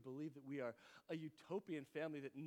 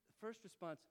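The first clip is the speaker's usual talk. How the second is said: usual speaking voice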